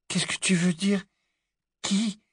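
high, light puzzled voice; intriguée. Acted. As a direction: ask in a high, small questioning voice. Hermione intriguée au réveil. (voix haute, intriguée)

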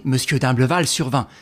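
ordinary delivery

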